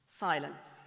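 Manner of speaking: usual speaking voice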